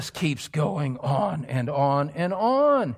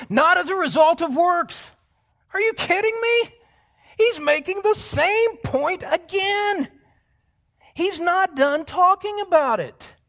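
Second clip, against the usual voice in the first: much higher and louder, incredulous; preacher's ecstatic cry over repeated point; excited preaching; 13 semitones higher, 4 dB louder than usual. Real, not acted. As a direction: shout it like you can't believe he's saying it again.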